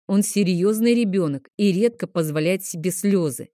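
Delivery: ordinary delivery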